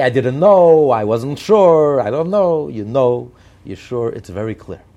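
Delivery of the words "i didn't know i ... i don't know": loud, drawn-out mock pleading; scornful, raised-voice imitation of a fence-sitter; mocking excuses. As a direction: mimic feeble excuses at high volume. Acting out evasive excuses, scornfully.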